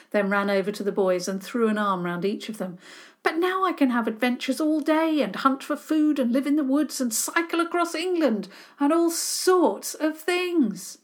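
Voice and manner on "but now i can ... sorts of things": higher, excited rush of plans; eager boy. A narrator's reading. Boy boasting to his rescuers, excited and high. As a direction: sell the adventure, bubbling over.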